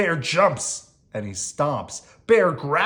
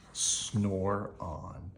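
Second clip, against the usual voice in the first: sleepy hush; 6 semitones lower, 10 dB quieter than usual. A narrator's reading. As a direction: lower and much softer, drifting off. Sleeping refrain.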